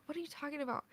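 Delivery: high, very soft mother voice; puzzled sleepy mom. Retold or imitated. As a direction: imitate a groggy mom, baffled.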